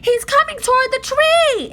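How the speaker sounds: high, loud alarmed cry; frightened cry. A narrator's reading. Annie crying out to Jack as the dinosaur charges, frightened. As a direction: cry out the danger. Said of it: "Annie cried".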